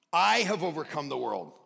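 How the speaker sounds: loud, ringing proclamation of "I have overcome the world"; emphatic repeat. Acted. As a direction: declare it loudly to the congregation. Pastor repeats verse with force.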